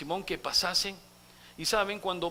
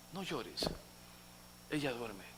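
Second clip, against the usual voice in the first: Jesús consolando; 7 semitones lower, 9 dB quieter than usual. Acted. Jesús consuela al padre.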